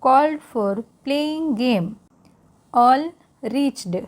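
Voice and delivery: slow, careful read-aloud for learners; English read-aloud for Hindi-speaking students, slow. Shown as a demonstration. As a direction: read it slowly so learners can follow along.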